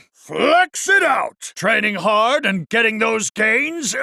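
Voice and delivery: rally them like a gym coach; rousing cheer. Acted. Dio cheering on training.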